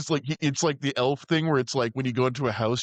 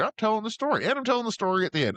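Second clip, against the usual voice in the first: high, lightly mocking narrator voice; lightly voiced storyteller elf; 11 semitones higher, faster than usual. Mimicking Papa Elf as a bookend narrator, lightly voiced. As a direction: pitch it up as a simple storyteller.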